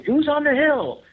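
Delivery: high, loud, strained call; Matt calling out. Retold or imitated. Matt yelling into the dark at a figure on the hill, high and loud. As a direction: belt it up the hill. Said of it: "Matt's repeated call".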